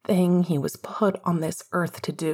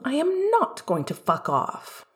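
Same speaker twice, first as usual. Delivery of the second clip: firm and higher, leaning on not; a lightly voiced stern friend refusing; stringent; 9 semitones higher than usual. A narrator's reading. James insisting to grieving Sirius, stringent. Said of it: "more stringently than he ever spoke".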